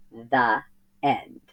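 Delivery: usual speaking voice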